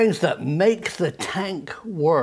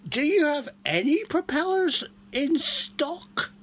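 bad acting; 12 semitones higher, 4 dB quieter than usual. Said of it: "if you excuse the bad acting".

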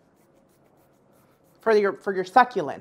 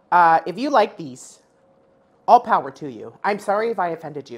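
normal talking voice

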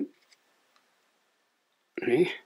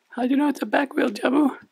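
squeaky, high cartoon puppet sidekick; chirpy little puppet, much higher; 4 dB louder, faster than usual. Puppet co-host Lani speaks.

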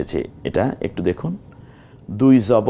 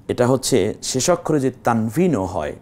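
ordinary talk, nothing marked

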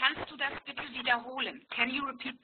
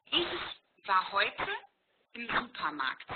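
slowed down, each word laid out for the learner; slow repeat; 3 semitones lower, slower than usual. Shown as a demonstration. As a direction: say it again slowly and clearly. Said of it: "What was that letter?". (langsam wiederholt)

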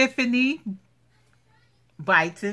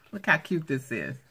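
ordinary talk, nothing marked

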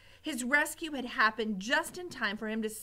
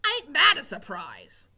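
louder, dry scorn; sarcastic cook; 4 semitones higher, 10 dB louder than usual. Acted. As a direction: sneer at the miss. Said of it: "said Cook".

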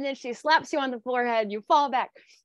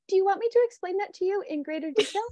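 higher, sweet customer-service tone; helpful support agent; 7 semitones higher than usual. Retold or imitated. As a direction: offer help like a headset helper. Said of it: "And I go".

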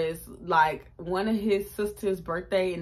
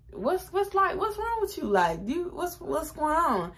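much higher, anxious questioning; imitating worried boyfriend; 8 semitones higher than usual. Girlfriend voices her boyfriend asking what's wrong, high and worried. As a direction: press her with concern.

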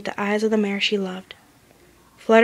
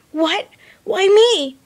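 shocked; 10 semitones higher, 6 dB louder, much slower than usual. A narrator's reading. Dash shocked.

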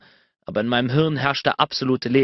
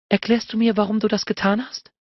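much higher woman's voice, rising; pointed demand; 8 semitones higher than usual. A narrator's reading. She demands Bennett explain himself, pointed. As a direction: push the du, demanding.

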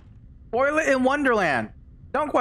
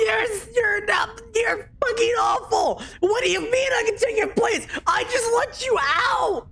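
very high, indignant screech; outraged screaming; 12 semitones higher than usual. Real, not acted. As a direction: wail accusations, stumbling over the words. Betrayed by the one freed.

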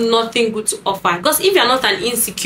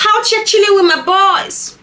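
high-pitched mock boast; hammed-up lad hanging with his boys, pitched way up; always-outside party guy; 10 semitones higher, 4 dB louder than usual. Party man reporting his Friday evening, high mocking boast. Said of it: "those men that are always outside".

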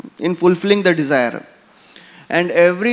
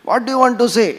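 higher-pitched imitation of an irritated demand; mildly mock-angry, pitched up; retold angry yell; 6 semitones higher, faster than usual. Prabhupada demanding the caller's business, retold mild yell. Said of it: "got mad and he yelled".

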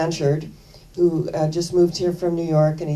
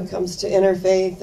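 normal talking voice

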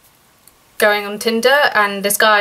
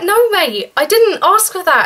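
indignant refusal; 6 semitones higher than usual. Retold or imitated. Retelling her refusal, still upset.

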